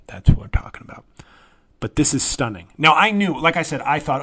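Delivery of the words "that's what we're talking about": whisper. Real, not acted.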